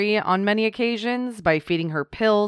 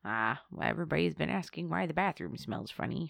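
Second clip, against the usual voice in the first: a hint of a frail old man, soft; 2 semitones lower, 12 dB quieter than usual. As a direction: murmur it like a tired old husband. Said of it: "this little frail old man".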